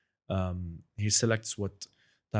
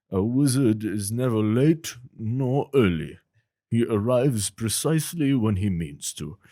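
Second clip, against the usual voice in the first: slow, louder grand wizard voice; Gandalf; 5 dB louder, slower than usual. Shown as a demonstration. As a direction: speak with slow wizardly dignity. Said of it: "a bit of Gandalf".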